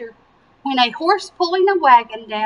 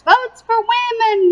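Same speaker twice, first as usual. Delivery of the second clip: higher, bright rallying call; cheerful slogan; 10 semitones higher, 4 dB louder than usual. A narrator's reading. Nell agreeing with Alice, eager rallying cry. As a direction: echo the cry with spirit. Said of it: "Nell agreed".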